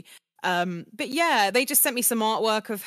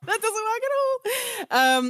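much higher, drawn-out laughing dismissal; laughing self-mockery; 4 dB louder, much slower than usual. Actress laughing at her own British audition, high laughing dismissal. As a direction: squeal it slowly through laughter.

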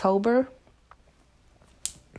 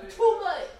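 calling off mic; 9 dB quieter, slower than usual. Real, not acted. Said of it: "'Cause I'm trying to do my podcast".

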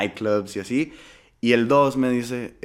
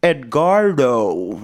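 put-on drawn-out English-accented "Edgardo", very slow and louder; hot potato in the mouth; 6 dB louder, much slower than usual. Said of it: "como si trajeran una papa en la boca". (como si trajeras una papa en la boca, muy lento)

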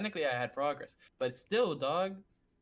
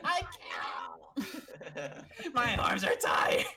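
high, drawn-out mock wail; whining protest; 3 semitones higher, much slower than usual. Lifter retells refusing to re-pick the weight, whining. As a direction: cry it out like a worn-out, hammed-up tantrum. Acted whine about tired arms.

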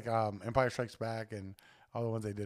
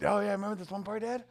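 higher, eager little boy imitation; excited kid voice; 10 semitones higher, 3 dB louder than usual. Father imitating son blurting spoilers in the theater, higher kid voice. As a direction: blurt it out like a kid who can't keep a spoiler.

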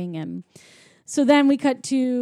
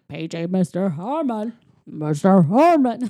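flat echoey PA page; 3 semitones lower, 3 dB louder than usual. Retold or imitated. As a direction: call it like a hotel intercom. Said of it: "obviously not his voice".

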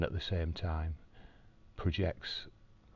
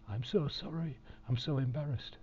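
higher, light imitation of a sorry nurse; playing the embarrassed nurse, pitched up; the nurse who spilled it, gushing a pitched-up sorry; flustered apologetic nurse; 9 semitones higher than usual.

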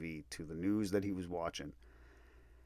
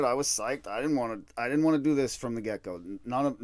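ordinary talk

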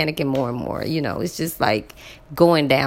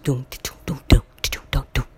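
imitating Arabic music beat; 2 semitones lower, faster than usual. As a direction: hum out the song's thud. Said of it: "I hear this Arabic music".

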